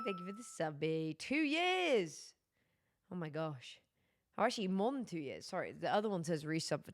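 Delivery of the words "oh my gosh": ordinary talk, nothing marked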